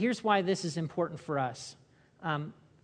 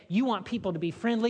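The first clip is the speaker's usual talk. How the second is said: ordinary talk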